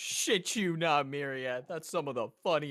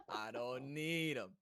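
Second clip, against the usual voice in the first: ordinary talk, nothing marked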